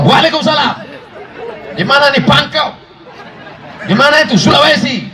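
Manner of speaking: high, brisk, no-nonsense phone answering; strict, businesslike telephone manner; firm phone voice. Shown as a demonstration. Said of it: "kalau ada telepon masuk harus tegas".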